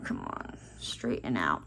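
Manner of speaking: hushed, barely voiced aside; near-silent coaxing aside; under-breath mutter. Real, not acted. Muttering to the dog nearby.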